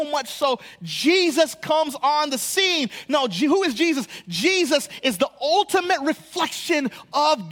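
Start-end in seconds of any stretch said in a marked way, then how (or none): none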